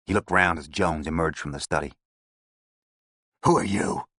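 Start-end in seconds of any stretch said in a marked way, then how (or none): 3.5-4.0 curt New York question; lightly American, sizing him up; New York accent. A narrator's reading. Gang boss to detective, clipped New York accent. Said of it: "It was his accent I had heard".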